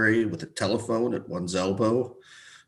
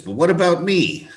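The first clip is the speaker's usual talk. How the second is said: louder, higher protest; indignant outburst; 8 semitones higher, 9 dB louder than usual. Acted. Old brother protesting to the doctor, loud indignant outburst. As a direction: burst out in self-pity. Old man complains of unlived life.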